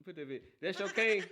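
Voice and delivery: ordinary delivery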